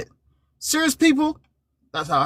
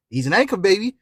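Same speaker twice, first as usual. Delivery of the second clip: ordinary talk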